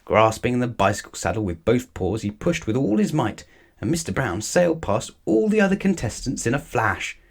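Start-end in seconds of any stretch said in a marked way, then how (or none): none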